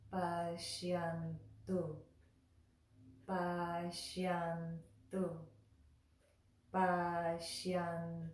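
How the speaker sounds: Pashyantu drawn out slowly; slow drilled word. Shown as a demonstration. Teacher drilling Pashyantu slowly for students. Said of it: "When you put it all together".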